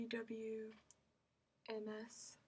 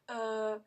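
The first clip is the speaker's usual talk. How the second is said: drawn-out "Uh" left hanging; hesitant filler demo; 6 dB louder than usual. Shown as a demonstration. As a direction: hold "Uh", then stop.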